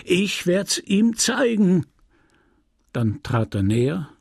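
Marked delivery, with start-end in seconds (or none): 0.0-1.9 high, slow, drawn-out menace; stretched, raised-pitch vindictive muttering to oneself; repeated threat. A narrator's reading. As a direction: gloat over the threat, repeating it. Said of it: "ständig wiederholend".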